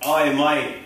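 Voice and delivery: hammed-up Australian pizza shop owner; mock Australian accent, played for laughs. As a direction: put on a broad comic Australian accent. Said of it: "I'll be an Australian pizza shop owner".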